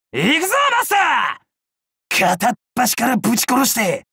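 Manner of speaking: high-pitched, gleeful battle cry; 意気込んだ雄叫び. Acted. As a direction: charge in hollering, pitch leaping up. Battle cry charging into a fight. (興奮した高い声の突撃の掛け声)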